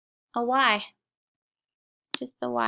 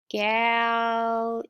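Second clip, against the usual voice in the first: "galley" stretched into gal... ee; "galley" held and split by syllable; sounded out. Shown as a demonstration. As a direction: sound out "galley" in two slow parts. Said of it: "sound it with me".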